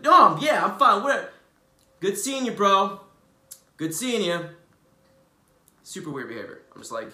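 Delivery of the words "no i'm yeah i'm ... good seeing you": high and loud sarcastic brush-off; huffy sulk. Retold or imitated. Offended friend leaving late at night, loud sarcastic sulk. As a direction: toss off a fake-polite goodbye, pitched up and loud. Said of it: "He's like".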